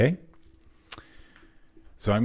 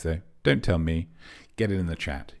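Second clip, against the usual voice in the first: ordinary talk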